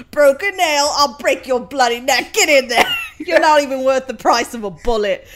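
high, loud hammed-up threat, laughing; imitating his rage. Quoting the angry man's reply, loud and laughing. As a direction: snarl it big and comic. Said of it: "And she's like".

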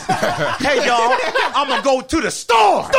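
high mock impression building to a yell; last word yelled. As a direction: shout the final word of the sentence. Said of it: "I just yell the last word".

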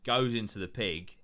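ordinary delivery

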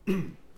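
short awkward throat clear. Acted. Two strangers averting their gaze, awkward throat clearing. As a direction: clear your throat before looking away. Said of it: "clearing their throats".

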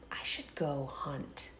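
hushed secret whisper. Retold or imitated. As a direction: whisper it to yourself. Quoting own thought, whispered.

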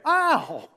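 high sudden yelp of pain; sharp mock cry of pain; comic yelp. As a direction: yelp when the wallet is touched. Wallet pressed again.

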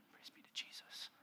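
barely voiced whisper; hushed, breathy whisper of praise; whispered aside. Real, not acted. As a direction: whisper it under your breath, almost inaudible. Hushed aside after bacon joke.

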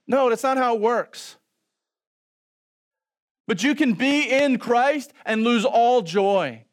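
high, loud preaching voice; full-force preaching. Acted. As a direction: preach it out hard.

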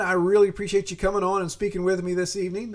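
ordinary talk

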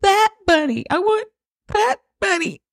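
put-on very high, pleading child's voice; little daughter refusing a new bunny; whining little girl. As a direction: beg like a kid who wants her bunny back. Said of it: "But she's like".

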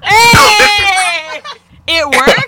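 high, drawn-out excited shout; loud whooping cheer. Real, not acted. Friend cheering a sex boast, excited whoop. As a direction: holler in celebration.

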